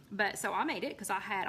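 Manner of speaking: usual speaking voice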